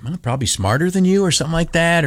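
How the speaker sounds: ordinary delivery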